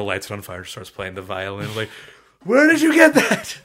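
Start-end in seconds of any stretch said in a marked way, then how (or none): 2.4-3.6 very high, loud incredulous cry; mock shocked shriek. Onlooker baffled by a sudden violin, mock shriek. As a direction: shriek the question in hammed-up disbelief.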